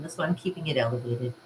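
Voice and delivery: normal talking voice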